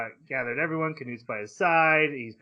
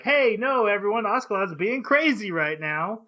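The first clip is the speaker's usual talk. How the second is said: mock call out; 7 semitones higher than usual. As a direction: call out over the crowd. Canute shouting over him.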